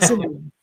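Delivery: TSIM-blum for "Cymbalum", middle vowel swallowed; corrected pronunciation. Shown as a demonstration. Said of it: "I gotta say it right".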